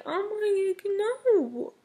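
high-pitched mock scream of disbelief; shocked reader's high cry of no, no; retold scream of shock. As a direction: squeal it up high like you're reliving the shock. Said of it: "I screamed".

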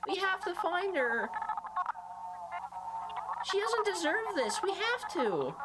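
put-on high-pitched pleading child voice; upset little girl Molly. Molly arguing with Ed to help her, high upset child. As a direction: protest like a hurt, indignant little girl.

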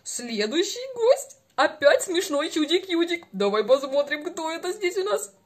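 normal talking voice

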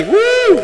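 high excited woo; cheer. Real, not acted. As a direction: whoop for the winner. Cheering after win.

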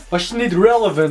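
ordinary talk, nothing marked

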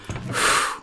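long, voiceless exhale; long sigh. Real, not acted. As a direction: let out a heavy breath. Relief after a game scare.

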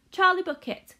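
high, loud call of a name. A narrator's reading. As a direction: call him over across the room. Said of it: "cried Mister Wonka".

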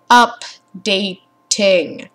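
Updating said very slowly, falling; drawn-out Updating. Real, not acted. Gamer grumbling the word Updating, very slow. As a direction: stretch out Updating.

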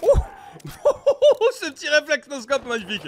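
slow, delighted laughing praise. Real, not acted. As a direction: savour the shot, laughing. Delighted at own no-scope shot. (en riant, ravi)

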